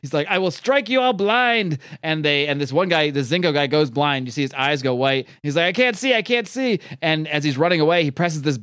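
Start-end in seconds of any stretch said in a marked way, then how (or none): 0.3-1.8 high, slow mock-menacing proclamation; hammed-up evil master; villain's threat. Rasputin threatens his minions. 5.6-6.8 hammed-up helpless cry; mock panic. Man struck blind cries out.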